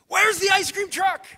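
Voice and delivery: high-pitched, all-out holler; full-volume acted shriek; frantic comic yell. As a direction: scream it like an overexcited kid, ironically anything but calm. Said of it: "so calmly and quietly".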